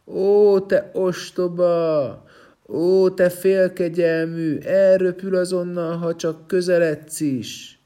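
high, loud, slow taunting; mock him loudly, drawing out the insults; jeering shouts. Stone men jeer at the climbing prince, loud slow taunts. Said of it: "mindenfélét kiabált a többi kőember".

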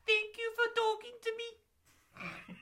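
high, slow put-on squeaky voice; Wayne Rooney impression. Mock Rooney thanks the interviewer, high and slow. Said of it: "my Wayne Rooney impression".

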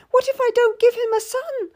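high, anxious question; fretting over a son; scared young lady. A narrator's reading. Lady fears failing the king, high anxious voice.

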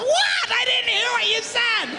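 very high, strained holler; shouting over noise. Acted. Husband yelling to wife beside busy highway, high frustrated holler.

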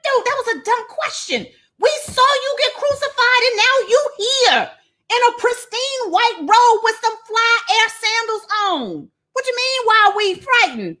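exasperated disbelief. Acted. Disciples telling Jesus his question was dumb, high and exasperated.

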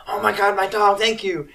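high, gushing thanks; frantic relief. Retold or imitated. Girl thanking the people chasing her runaway dog, frantic relief. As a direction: imitate a frantic, grateful dog owner.

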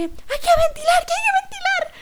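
high, loud mock panic from a stranger on the bus; hammed-up high yell of a panicking woman; frantic woman yelling. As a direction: squeal it like a desperate passenger begging for air. Woman insists on ventilating.

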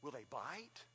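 put-on soft timid child's question; lightly voiced scared kid; small scared child. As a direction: ask it like a frightened little boy. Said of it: "Perry said".

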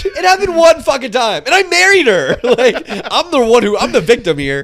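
high, loud mock-outraged defense; indignant protest. Real, not acted. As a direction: protest loudly, playing the wronged party. Protesting teasing about his past.

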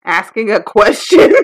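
loud, unsure answer breaking into laughter; laughing guess. Real, not acted. Friend guessing at a quiz question, laughing.

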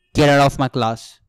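loud, stern mock order; serious professor. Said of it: "gets serious".

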